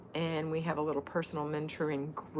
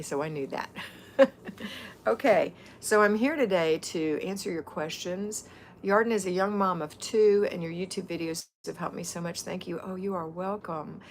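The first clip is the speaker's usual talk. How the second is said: usual speaking voice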